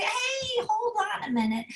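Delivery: high, laughing call of hey; playful interruption. Acted. Parent stops the child's self-talk with a high laughing hey. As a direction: cut in warmly with a laughing shout.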